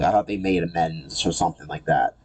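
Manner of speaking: ordinary talk, nothing marked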